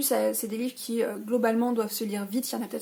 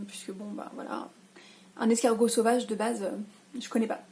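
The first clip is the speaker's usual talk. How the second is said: whispered amused aside; conspiratorial whisper about the snail. Real, not acted. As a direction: hush the voice to an airless whisper.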